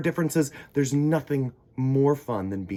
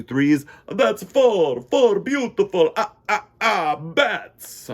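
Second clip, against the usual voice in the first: higher, louder, slow count; counting vampire; 8 semitones higher, 7 dB louder, slower than usual. Acted. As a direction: count bats with vampire glee.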